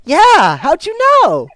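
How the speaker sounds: put-on high, loud, astonished kid; amazed young boy. Boy amazed at stranger's guess, astonished and young. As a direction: blurt it out in boyish amazement.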